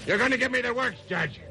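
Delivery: snarling gangster threat at the judge; defiant threat. Acted. Convicted killer threatens the judge, snarling. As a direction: spit the threat at the bench.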